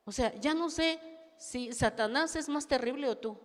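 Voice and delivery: ordinary talk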